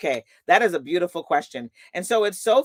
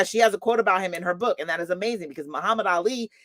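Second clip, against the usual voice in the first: ordinary delivery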